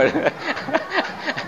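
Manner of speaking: high, soft chuckle; laugh. Real, not acted. Preacher amused that nobody would know, soft laughing. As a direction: laugh lightly to yourself after a quip. Laughs after own joke.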